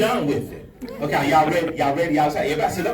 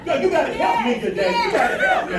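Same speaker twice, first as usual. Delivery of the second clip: excited laughing plea; 10 semitones higher, 3 dB louder than usual. Real, not acted. Laughing, pleading with the crowd.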